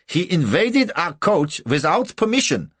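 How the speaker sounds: loud, high-pitched outrage; angry accusation. A narrator's reading. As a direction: lodge an indignant complaint. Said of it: "Angrily, he demanded".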